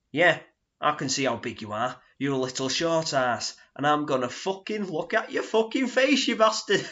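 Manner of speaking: hammed-up pub thug yelling; imitating an aggressive drunk, laughing; mock shout. Lout threatening in pub story.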